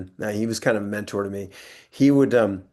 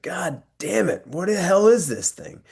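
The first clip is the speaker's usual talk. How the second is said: higher, hammed-up disgusted outburst; mock disgust; 8 semitones higher, slower than usual. Actor tasting a weak Bloody Mary, mock disgust. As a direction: spit it out like a drink gone wrong.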